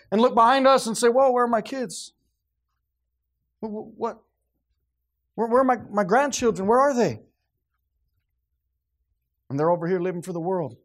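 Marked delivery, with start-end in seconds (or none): 1.1-7.2 loud, high, stammering alarm; startled panic. Acted. Grandparent turning round, finding the grandchildren gone, startled. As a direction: look back and find nobody there. Parent finds kids gone.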